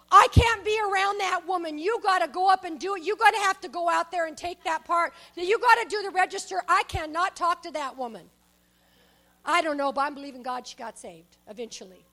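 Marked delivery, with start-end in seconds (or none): 0.1-8.3 retold loud protest. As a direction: push him to the register. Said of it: "and she goes".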